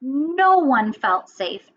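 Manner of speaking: plain reading voice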